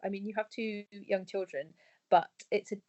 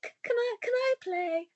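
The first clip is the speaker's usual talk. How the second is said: small, high plea, much higher; timid child-like ask. Retold or imitated. Mum asking the kids if she can play, in a small high voice. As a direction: ask like a shy kid hoping to be let in.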